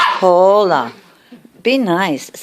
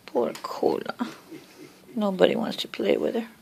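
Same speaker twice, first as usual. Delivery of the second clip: whispered pity; 10 dB quieter than usual. Real, not acted. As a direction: breathe the words with pity. Pitying the dog in whisper.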